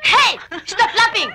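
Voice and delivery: high, sharp telling-off; indignant scolding. Acted. Woman orders visitor to stop laughing, indignant.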